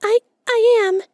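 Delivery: very high, small frightened squeak; timid squirrel voice. A narrator's reading. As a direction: stammer it out like a scared little squirrel. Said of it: "he said in a very low voice".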